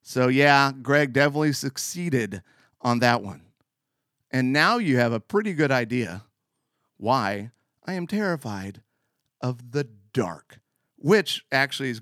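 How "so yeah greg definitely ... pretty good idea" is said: usual speaking voice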